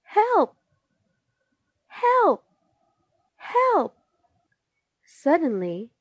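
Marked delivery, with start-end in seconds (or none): 0.0-4.0 very high, loud cries; story-book yells for rescue, pitched way up; calling for help. A narrator's reading. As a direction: cry out urgently, falling on each call. Little man grabbed, calls out.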